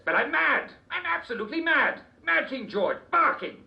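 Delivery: high, loud, gleeful raving; hammed-up lunatic king; raving mad king. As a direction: proclaim your madness with glee. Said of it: "you do sound a little upset".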